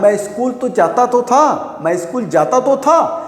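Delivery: teacher demonstrating the emphatic past; emphatic stress. Shown as a demonstration. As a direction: insist on जाता तो था. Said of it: "emphatic form में use करना है".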